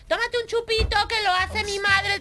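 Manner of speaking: pushy bar owner. Retold or imitated. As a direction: coax her into a drink, pitch up. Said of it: "el típico del local". (dueño del bar insistiendo, voz aguda)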